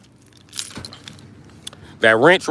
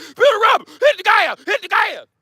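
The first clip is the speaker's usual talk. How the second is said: mock country hype; 13 semitones higher than usual. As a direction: hype up your buddy like a Southern car fan.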